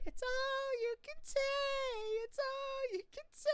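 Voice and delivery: put-on very high sing-song little-girl taunt; frighteningly accurate Dee Dee voice. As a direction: tease like a prancing little sister. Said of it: "Dee Dee prances around".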